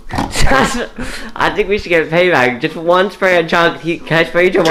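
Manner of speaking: loud, high, slow playful taunt; excited. Real, not acted. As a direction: hype up the payback, loudly. Playful payback scheme, loud.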